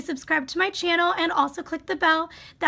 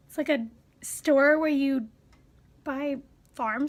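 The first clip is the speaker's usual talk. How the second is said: ordinary talk, nothing marked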